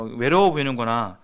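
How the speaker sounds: ordinary delivery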